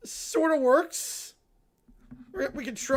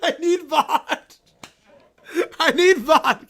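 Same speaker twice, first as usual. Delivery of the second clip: laughing; 3 semitones higher, 7 dB louder than usual. Real, not acted. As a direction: giggle through the request. Streamer repeats the line laughing.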